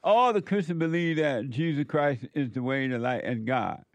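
normal talking voice

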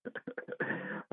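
quiet, breath-only laugh; low-volume amused laugh; soft chuckle. Real, not acted. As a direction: chuckle softly at your own fib. Laughing at his own lie.

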